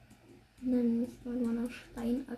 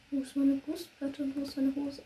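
ordinary talk

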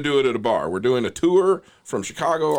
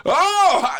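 much higher, louder "oh!" of realization; building laugh; 7 dB louder than usual. Acted. Audience member finally getting the joke, big acted laugh. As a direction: burst out as the joke fully lands. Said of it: "it takes a second and then it just builds into it".

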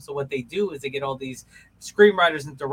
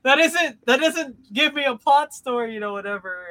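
exasperated reply; 8 semitones higher, 9 dB louder than usual. Retold or imitated. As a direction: scoff at the idea with loud disbelief. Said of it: "It's like".

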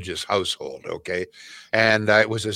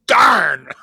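loud, high cry of pain; mock shout; 8 semitones higher, 9 dB louder than usual. Grandpa kicked by a horse, loud pained shout. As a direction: yell it like you've just been kicked. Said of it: "closest he ever came".

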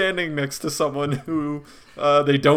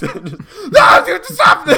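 high, loud panicked yelp; desperate cry; 8 dB louder, much slower than usual. Retold or imitated. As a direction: cry out from under the pile. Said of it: "true human desperation".

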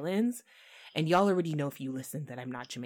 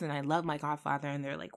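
ordinary delivery

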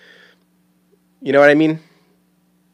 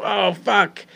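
stubbed-toe curse; 8 semitones higher, 4 dB quieter than usual. Acted. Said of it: "if you trip and you stub your".

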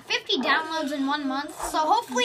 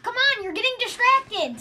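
raised, higher-pitched scolding; exasperated scolding; 7 semitones higher, 4 dB louder than usual. Real, not acted. Host telling off distracted co-host, exasperated scolding.

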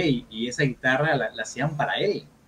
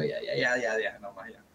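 softer and softer, lower "ya, ya"; fading brush-off; 3 semitones lower, 7 dB quieter than usual. Retold or imitated. Guitarist brushing off the friend's apology, fading to quiet. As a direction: wave him off, voice dying away. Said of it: "y yo".

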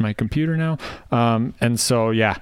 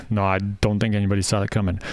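ordinary delivery

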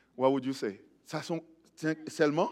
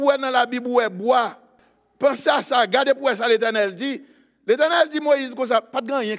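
high, loud call to the congregation; full-force preaching; 10 semitones higher, 9 dB louder than usual. Acted. Preacher calling on the church to listen, full-force preaching. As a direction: rouse the church and demand they listen.

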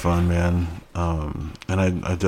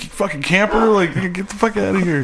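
higher mock gamer rant; gamer rage; 11 semitones higher than usual. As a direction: yell at the camper, half joking. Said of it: "And I'm like".

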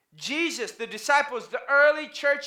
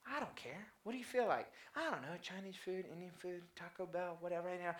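much softer and lower, couldn't-care-less mumble; mock indifferent mumble; 4 semitones lower, 17 dB quieter than usual. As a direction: shrug it off in a soft, low murmur. Group deciding where to eat.

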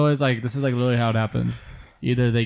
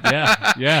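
laughing through the words; real laugh, higher and louder; 10 semitones higher, 5 dB louder than usual. Real, not acted. Laughing over the killed character.

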